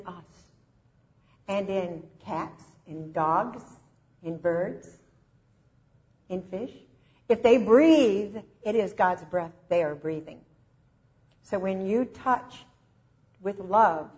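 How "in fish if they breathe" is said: ordinary delivery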